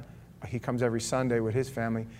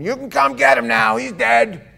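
much louder, higher urgent voice; retold frantic call; 10 semitones higher, 13 dB louder than usual. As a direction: deliver the news in raw panic. Mother's call about son's death.

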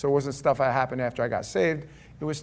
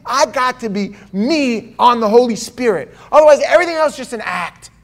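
much higher, much louder sermon peak; preaching at full force; 12 semitones higher, 16 dB louder than usual. Acted. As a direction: drive the point home from the pulpit. Preaching climax.

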